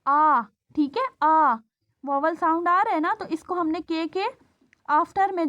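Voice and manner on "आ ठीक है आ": आ stretched, the second one loud; sounding out aa. Shown as a demonstration. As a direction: isolate the vowel आ, then call it out. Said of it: "दरमियान में vowel आ रहा है काम".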